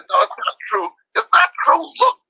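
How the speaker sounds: high-pitched, insistent arguing; heated rebuttal. Retold or imitated. Speaker arguing with friends over fact checks, insistent and high-pitched. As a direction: push the denial, voice climbing sharply.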